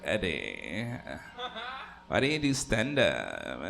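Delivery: mock his cousin's lazy Kardashian whine. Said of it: "the lazy Kardashian voice".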